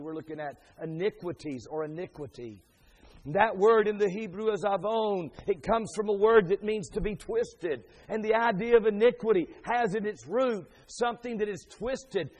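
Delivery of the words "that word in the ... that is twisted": raised, louder expository preaching; emphatic preaching. Real, not acted. Preacher explains Hebrew word for iniquity to congregation, emphatic preaching. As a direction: drive the word's meaning home to the room.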